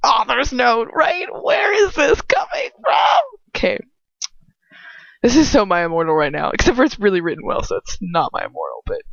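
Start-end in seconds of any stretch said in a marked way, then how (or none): 0.0-3.2 climbing to a high squeal; hammed-up high-pitched exclamation; mock outburst. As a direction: cry it out in over-the-top disbelief. Mock outburst at author's note.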